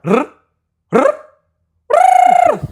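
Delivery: R trilled on the tongue, in bursts that grow longer; rolled R. Shown as a demonstration. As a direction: roll the R in repeated long trills. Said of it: "how to roll my R's".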